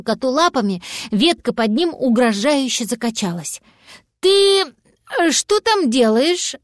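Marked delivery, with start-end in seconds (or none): 4.2-6.6 high, hesitant, drawn-out question; cautious. A narrator's reading. Cat to little dragon up a tree, cautious. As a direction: ask carefully, slowing down. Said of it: "осторожно спросил кот".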